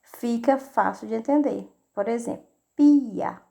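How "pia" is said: "pia" said loud as two beats, pi then a; "pia" split into pi and a; split syllables. Shown as a demonstration. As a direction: separate "pi" and "a" clearly, louder. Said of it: "Quando vamos separar a sílaba".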